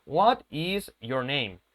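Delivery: "What is" uncontracted, slow and spaced; slow demo with "What is" kept unlinked; words pronounced separately. Shown as a demonstration. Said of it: "las pronunciemos por separado".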